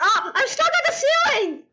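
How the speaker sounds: high-pitched yelp of alarm; alarmed outcry. Real, not acted. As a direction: cry out high at the sudden mishap. Suddenly stuck in the game.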